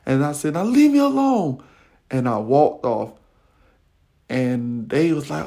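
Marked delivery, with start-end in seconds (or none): 0.5-1.6 much higher, louder yell; yelled warning to the bully; angry shout. Retold or imitated. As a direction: shout him off after the fight. After punching the kid.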